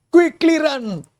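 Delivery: high, loud mouse shout. Acted. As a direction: yell the warning in panic. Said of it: "shouts the town mouse".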